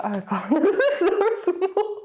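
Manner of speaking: high, tight laughing through words; nervous laugh. Real, not acted. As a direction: laugh nervously while talking. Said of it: "This is a nervous laugh".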